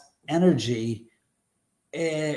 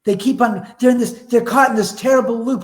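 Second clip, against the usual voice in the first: hammed-up horror, higher, louder and quick; mock dramatic; 7 semitones higher, 5 dB louder, faster than usual. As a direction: ham up the ghost-story dread. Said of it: "'Cause I said".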